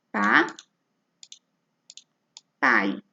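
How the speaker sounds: "Pa", long pause, then "pai" on its own; split into syllables. Shown as a demonstration. Teacher separating papai for pupils, split into syllables. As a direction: break papai into Pa and pai, far apart. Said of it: "A e i também ficam na mesma sílaba".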